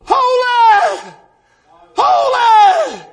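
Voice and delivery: sudden high, loud holy shout; Brother Paul. Retold or imitated. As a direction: burst out hollering in imitated worship. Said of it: "He went".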